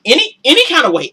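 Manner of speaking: ordinary talk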